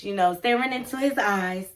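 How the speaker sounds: ordinary talk, nothing marked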